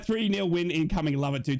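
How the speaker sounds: ordinary talk, nothing marked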